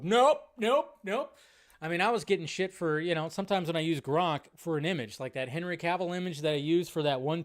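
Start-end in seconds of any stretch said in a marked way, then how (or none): 0.0-1.4 high mock refusal, shaking it off; imitating a dismissive crowd; dismissive nope. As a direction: toss off a curt nope nope nope. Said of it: "people just go".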